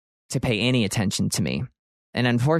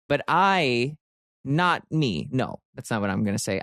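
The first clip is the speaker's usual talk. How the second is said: usual speaking voice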